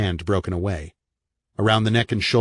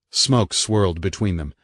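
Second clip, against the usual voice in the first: plain reading voice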